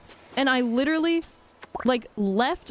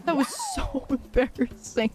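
laughing through the words; 7 semitones higher than usual. Real, not acted. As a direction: laugh while you say it. Laughs at own clumsy move.

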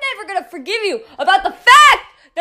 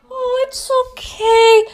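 slow, higher, drawn-out mimicry; mock reassurance; 4 semitones higher, 3 dB quieter, slower than usual. Ranter mimicking someone excusing a friend, mock reassurance. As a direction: imitate them soothing, sarcastically.

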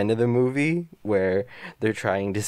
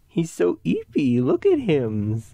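gushing; 6 semitones higher, 6 dB louder than usual. Real, not acted. Gushing over sleeping cat.

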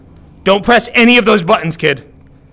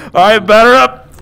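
excited; 4 semitones higher, 6 dB louder than usual. Real, not acted. Hypes up the swing.

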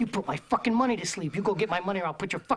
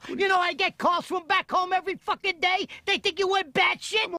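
rising angry rant; 8 semitones higher than usual. Acted. Heated rant at the boss.

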